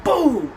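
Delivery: loud, high mock hammer blow "Boom!". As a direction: slam out the sound of a nail going in. Imitating nails hammered in.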